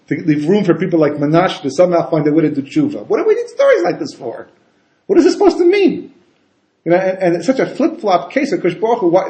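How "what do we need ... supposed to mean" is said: mock bewilderment. Mock bewildered rhetorical questions.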